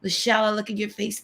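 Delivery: normal talking voice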